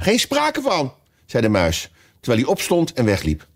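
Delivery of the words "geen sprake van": high-pitched indignant refusal; angry refusal. A narrator's reading. Mouse rejecting Alice's help, high and cross. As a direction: refuse outright, voice shooting up.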